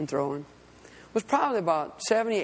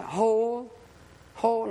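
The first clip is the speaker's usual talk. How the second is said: voice of the Spirit; 4 semitones higher than usual. Retold or imitated. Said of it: "I could hear the Spirit".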